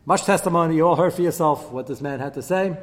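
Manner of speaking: loud, high, hammed-up accusation; princes' accusers demanding his death, loud and high; accusers' charge. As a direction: prosecute him before the cabinet. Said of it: "They said".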